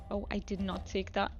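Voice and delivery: ordinary talk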